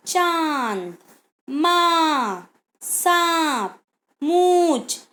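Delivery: चांद माँ सांप मूंछ through nose and throat; nasalised vowels. Shown as a demonstration. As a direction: stretch each nasal vowel. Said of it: "गले और नाक दोनों".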